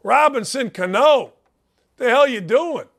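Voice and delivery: ordinary talk, nothing marked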